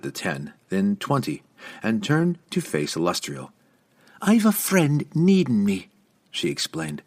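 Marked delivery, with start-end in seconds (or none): 4.2-5.9 raised, tense warning; even and dangerous. A narrator's reading. Young woman facing the lady at a locked door, dangerous. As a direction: press each word as a threat. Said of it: "her tone even and dangerous".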